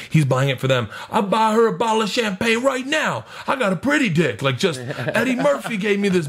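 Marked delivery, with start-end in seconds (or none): 1.1-4.4 high-pitched boisterous bragging; imitated party braggart, pitched way up; loud and boisterous. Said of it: "like, loud and boisterous". 5.1-6.3 high boisterous boast; imitated high-pitched show-off; loud and boisterous. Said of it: "like, loud and boisterous".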